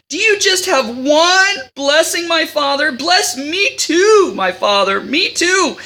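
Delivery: high, slow hammed-up weeping plea. Esau begs his father, stretching out a mock weeping plea. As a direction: drag out the begging like a crybaby. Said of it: "Esau lifted up his voice and wept".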